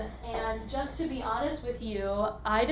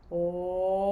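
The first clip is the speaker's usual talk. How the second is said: demo vocal slide; 4 semitones lower than usual. Shown as a demonstration. Teacher demonstrates the O slide to students, gliding smoothly. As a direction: glide the O sound downward as the arms go up. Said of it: "I'm gonna go like this".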